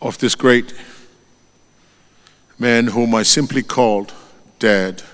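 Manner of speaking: ordinary talk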